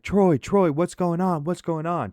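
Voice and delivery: high, urgent voice of a worried neighbor; urgent calming. Retold or imitated. Woman sprinting out to calm him down, urgent. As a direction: call his name high, then ease off in volume. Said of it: "trying to calm him down".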